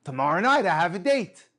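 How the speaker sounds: retold excited declaration; triumphant reveal. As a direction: proclaim the good news. Man reveals his date, story's punchline.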